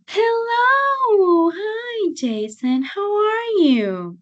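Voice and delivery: high, drawn-out singsong hello; very excited greeting. Acted. Teacher acts out someone greeting Jason, very excited. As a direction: gush with overdone delight, stretching the vowels. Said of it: "they are very excited to see you".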